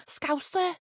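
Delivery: high-pitched attempt at Scouse; mock Scouse, pitched well up; Scouse accent. Shown as a demonstration. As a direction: try the word in a put-on Liverpool accent. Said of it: "I can do a Scouse accent usually".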